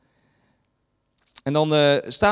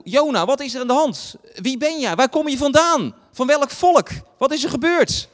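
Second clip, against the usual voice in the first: frightened crew pressing Jona for answers; urgent sailors questioning; 7 semitones higher than usual. Retold or imitated. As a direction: demand answers from Jona in alarm.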